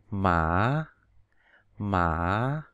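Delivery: slow rising "mã"; Mandarin second tone. Shown as a demonstration. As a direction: slide "mã" upward. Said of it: "đọc từ thấp lên cao".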